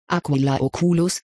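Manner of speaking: ordinary delivery, nothing marked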